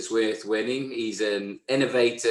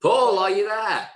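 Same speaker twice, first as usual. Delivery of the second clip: raised, high call to check someone's there; 8 semitones higher, 4 dB louder than usual. Real, not acted. Co-host to Paul on a silent link, calling out. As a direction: call out to someone who can't hear.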